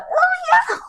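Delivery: tiny, squeaky, very high voice; small meek voice. Acted. Acting out someone believed to be small, tiny squeaky voice. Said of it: "believed to be small and like".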